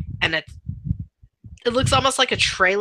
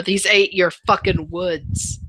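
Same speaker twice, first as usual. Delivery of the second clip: ordinary talk, nothing marked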